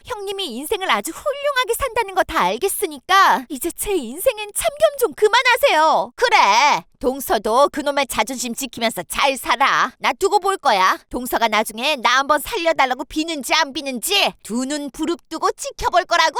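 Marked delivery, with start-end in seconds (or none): 0.1-6.1 higher and louder, rising into a furious plea; angry retort. Acted. To her meddling sister-in-law, rising angry retort. 6.2-14.3 angry threat. Acted. Older sister-in-law storms off threatening.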